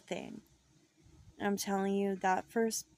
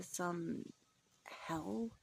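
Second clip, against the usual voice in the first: whispered hell; 2 semitones lower, 9 dB quieter than usual. A narrator's reading. Ominous hushed word.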